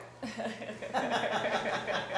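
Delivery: amused chuckle; real laugh mid-story. Real, not acted. Storyteller amused at the man's questions, laughing.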